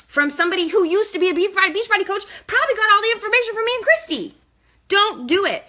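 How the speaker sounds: high, fast, fired-up rant; heated, rapid and loud; loud sharp voice. Real, not acted. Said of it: "she seems so worked up".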